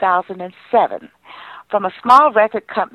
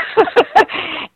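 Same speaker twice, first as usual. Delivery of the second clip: breathy laugh. Real, not acted. Laughs at memory of Jesse.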